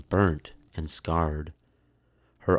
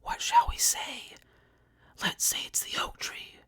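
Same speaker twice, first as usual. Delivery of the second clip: desperate hushed whisper; 5 dB quieter than usual. A narrator's reading. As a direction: confer in panic, whispering. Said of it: "they whispered".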